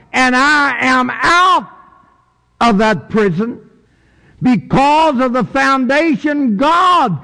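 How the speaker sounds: high, forceful pulpit proclamation; impassioned preaching. Acted. Preacher proclaiming freedom from prison through God, impassioned. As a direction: build into a high, ringing sermon cry.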